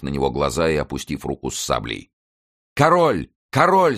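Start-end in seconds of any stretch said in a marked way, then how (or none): 2.7-4.0 high, loud cries of alarm; crowd shouting. A narrator's reading. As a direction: shout it out on spotting him. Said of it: "закричали несколько англичан". (громкие высокие выкрики)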